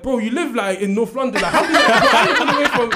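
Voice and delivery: loud, high laughing amazement; laughing disbelief. Real, not acted. Laughing to friends, amazed the dad found out.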